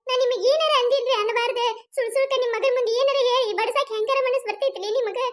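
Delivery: ordinary talk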